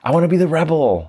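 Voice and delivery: put-on high whiny kid voice; childish protest. [name] protesting to his guru, high whiny kid voice. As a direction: whine it like a stubborn child.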